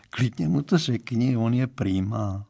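plain reading voice

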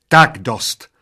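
loud, sharp waitress outburst; shrieked. A narrator's reading. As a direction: yell it to put a stop to them. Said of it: "zaječela číšnice". (zaječet, hlasitě a rázně)

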